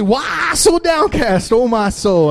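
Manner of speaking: slow, loud mock-dramatic call; hammed-up slow proclamation; playful proclaiming. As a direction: declare it to cheer her up. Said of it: "I said".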